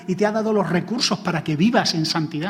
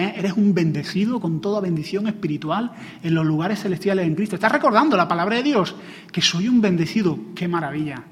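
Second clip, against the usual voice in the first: ordinary talk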